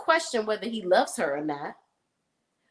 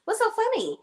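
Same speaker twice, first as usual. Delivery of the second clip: mocking jealous wife; 11 semitones higher than usual. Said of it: "She's like".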